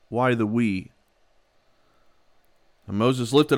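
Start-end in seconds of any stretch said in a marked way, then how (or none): none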